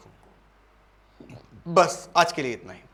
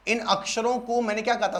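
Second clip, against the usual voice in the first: normal talking voice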